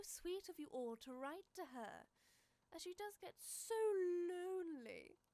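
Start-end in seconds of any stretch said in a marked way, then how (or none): 2.7-5.2 hammed-up fake sob; mock pity. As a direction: pretend to weep for her. Villain mocks Lisa's loneliness.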